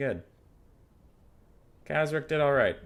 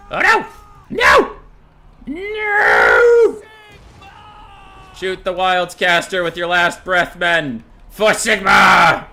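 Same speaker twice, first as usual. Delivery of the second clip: high, loud anguished yell; desperate shout; 7 semitones higher, 16 dB louder, much slower than usual. Acted. Commander screaming at his men for a final shot, desperate. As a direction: cry out as the line collapses. Troops in despair, last volley.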